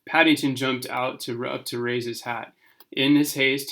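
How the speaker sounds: usual speaking voice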